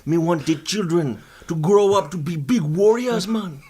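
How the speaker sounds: earnest mock Jamaican accent; fake Jamaican accent. Guest claiming good intentions to doubting host, fake Jamaican accent. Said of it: "this is not your real accent".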